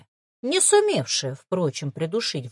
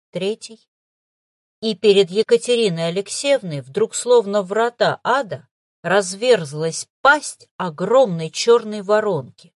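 plain reading voice

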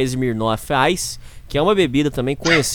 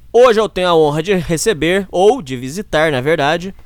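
loud, slowed guest introduction; big hype for the guest; excited announcer; 5 semitones higher, 3 dB louder than usual. Real, not acted.

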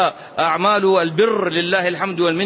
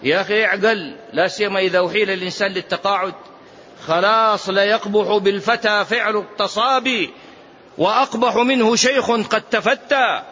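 ordinary delivery

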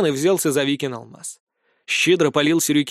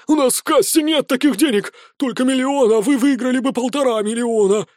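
panicked; 8 semitones higher, 3 dB louder than usual. A narrator's reading. Panicking casino manager blurts.

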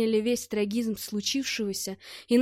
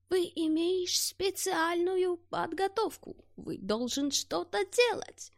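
put-on old soldier with foreign accent, softer, slow and higher; colourless voice; 8 semitones higher, 3 dB quieter, slower than usual. A narrator's reading. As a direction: murmur in despair like a broken old foreigner. Old soldier keeps pleading. (бесцветный голос старого солдата-иностранца, тише и медленнее)

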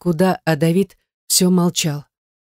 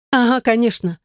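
higher-pitched stubborn retort; stubborn snort; 6 semitones higher than usual. A narrator's reading. She snorts back at his claim, stubbornly. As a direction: toss it back, stubbornly dismissive. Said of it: "фыркнула она упрямо".

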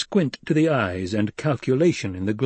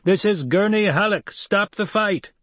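calling through cupped hands; 10 semitones higher than usual. Acted. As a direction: call it out across the battlefield. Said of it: "cupped his hands for a megaphone".

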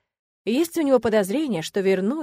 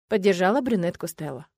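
plain reading voice

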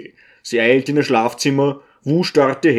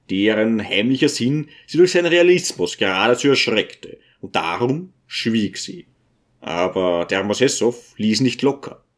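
usual speaking voice

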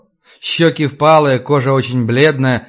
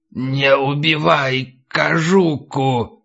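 put-on slow, creaking old crone; creaky old voice; much slower than usual. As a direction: drag it out like a frail old creature. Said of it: "Голос скрипел, словно с ним говорил старик или старуха". (скрипучий старческий голос)

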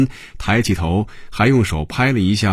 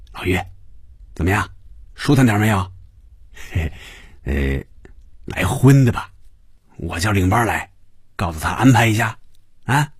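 lightly voiced sly businessman, coaxing; chummy chuckles between cajoling questions; chummy wheeler-dealer. A narrator's reading. Fan Yuzhou offering his partner more entertainment, chummy and coaxing.

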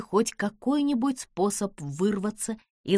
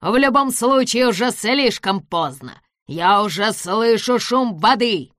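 gloating; 3 semitones higher, 7 dB louder than usual. A narrator's reading. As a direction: relish the sound of water. Villain gloats over flood.

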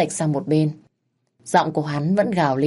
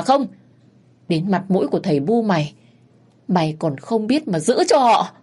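cursing while hitting; 4 semitones higher than usual. Acted. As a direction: rage at him as you strike. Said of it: "Chị vừa đánh vừa chửi".

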